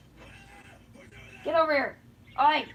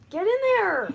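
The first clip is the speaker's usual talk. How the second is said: frustrated shout; 6 semitones higher, 7 dB louder than usual. Real, not acted. Yelling at crickets into cage.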